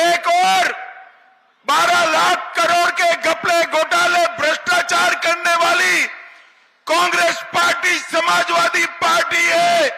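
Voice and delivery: high, loud attack on rivals; booming political rally speech. Real, not acted. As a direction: hammer the list at the crowd.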